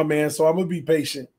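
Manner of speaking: ordinary talk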